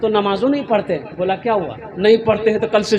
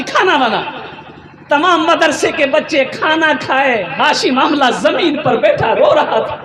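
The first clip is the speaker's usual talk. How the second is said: raised, swinging preacher's cadence; fervent preaching; 6 semitones higher than usual. Acted. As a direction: exhort the congregation with rising fervour. Preaches at full force.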